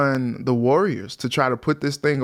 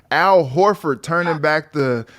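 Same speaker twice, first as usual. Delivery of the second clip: ordinary talk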